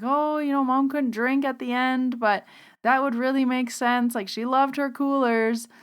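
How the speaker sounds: ordinary delivery, nothing marked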